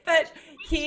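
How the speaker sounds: laughing high and loud through the words. Real, not acted. Host laughs about silent French letters, loud laugh. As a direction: laugh it off and try to go on.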